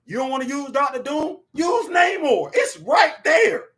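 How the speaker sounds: high, loud exasperated pitch; high frustrated shout; exasperated suggestion. Retold or imitated. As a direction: shout the obvious fix at the studio. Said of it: "All they had to do was".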